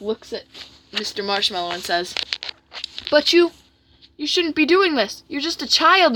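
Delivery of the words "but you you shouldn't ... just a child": higher-pitched cartoon scolding; protesting friend. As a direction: object in a high character voice. Jack scolds Marshmallow Man.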